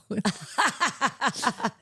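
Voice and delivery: helpless giggles over bubbly water; giggling fit. Real, not acted. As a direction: burst out giggling. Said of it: "What are you laughing about".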